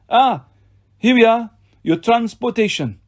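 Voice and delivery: put-on high-pitched villain with a French accent; pleased French-accented villain. A narrator's reading. Doctor welcomes his transportation, pleased French-accented villain.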